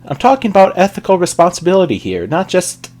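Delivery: high, loud moral reproach; scolding. A narrator's reading. Starlight lecturing Trixie on responsibility, scolding. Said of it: "Starlight scolded".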